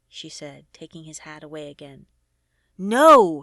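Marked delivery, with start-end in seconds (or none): 2.8-3.4 sudden loud roar of No!; furious loud roar refusing her. A narrator's reading. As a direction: blast the word out at her. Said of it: "he roared".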